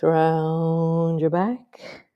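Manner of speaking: very slow, stretched-out instruction; long, slow yoga cue; drawn-out movement cue. Real, not acted. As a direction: stretch the first word to pace the movement.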